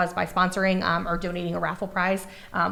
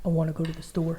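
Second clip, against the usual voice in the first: lightly lowered teen boy impression; teenage boy voice; 3 semitones lower than usual. Friend asks her to sound like a teenage boy, mild attempt. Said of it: "try to talk like a teenage boy".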